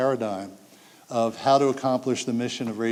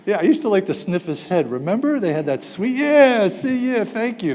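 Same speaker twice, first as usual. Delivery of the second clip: high, loud playful self-mockery; gleefully silly, much higher and louder; giddy mock sniff; 8 semitones higher, 6 dB louder than usual. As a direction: ham up the sweet head-sniffing. Mocking the sniffing dad.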